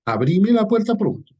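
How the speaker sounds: "Abrime" gritado más agudo y fuerte; llamado venezolano. Shown as a demonstration. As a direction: llamar a la puerta a voz en cuello. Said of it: "esa señal de cultura venezolana". (grito alegre de parranda venezolana)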